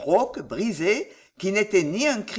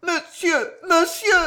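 very high, tearful cry; terrified plea; 10 semitones higher, 5 dB louder, slower than usual. A narrator's reading. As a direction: cry out desperately, growing louder. Wife begs accuser in terror.

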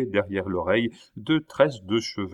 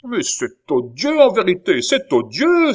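rising, much higher and louder outcry; exclaiming in outrage; 10 semitones higher, 8 dB louder than usual. A narrator's reading. Baron crying out at his daughter, outraged. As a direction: throw up your hands in disgust. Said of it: "s'écria Tavernet".

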